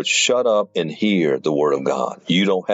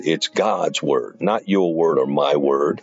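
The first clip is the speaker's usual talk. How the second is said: ordinary talk, nothing marked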